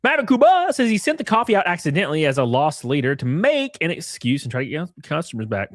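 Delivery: normal talking voice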